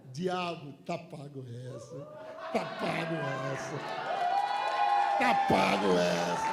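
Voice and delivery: building from calm to a loud low shout; growing defiance, end shouting; taunting the devil. Acted. (desafiando o diabo, crescendo até gritar)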